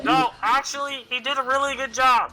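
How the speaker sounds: high, slowed call down below; yelling over cliff. Acted. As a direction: project each word down a cliff. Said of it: "I'm yelling over the cliff".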